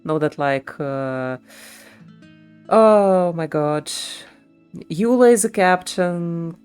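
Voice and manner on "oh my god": ordinary delivery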